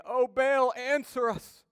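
high, loud, slow cry to a silent god; the Baal priests crying out to their god, loud and pleading; pleading call. Retold or imitated.

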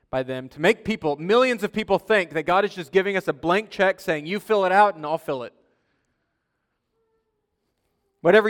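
loud, emphatic pulpit delivery; forceful preaching. Real, not acted. Pastor warns congregation against their own strength, forceful preaching. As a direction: drive it home to the congregation, loudly.

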